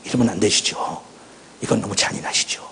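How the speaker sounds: retold weeping plea. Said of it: "통곡하기 시작했어요".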